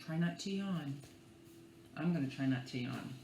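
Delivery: ordinary delivery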